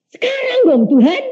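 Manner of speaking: high, whiny plea; man begging God for ordination now; childish pleading. Retold or imitated. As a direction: beg like an impatient child.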